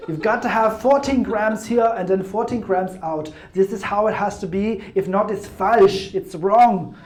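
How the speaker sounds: put-on German accent, insistent. German coffee trainer insisting on exact grams, German accent. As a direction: mimic a strict German precision stickler. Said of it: "I put on this German accent".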